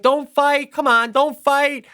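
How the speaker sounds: high, drawn-out plea; imitated pleading kid. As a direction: mimic a child begging parents to stop. Voices daughter pleading with parents.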